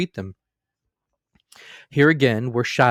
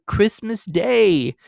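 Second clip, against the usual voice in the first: higher, very slow, gentle; mild plea; 11 semitones higher, 5 dB louder, much slower than usual. A narrator's reading. Husband reminding wife of the day, slow mild plea. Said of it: "was Bob's mild answer".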